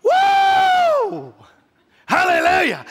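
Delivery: a high, loud whoop into hallelujah; joyful whoop. Retold or imitated. His optimist side celebrating, whooping loud. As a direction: let out a celebrating cry. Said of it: "my seven's going".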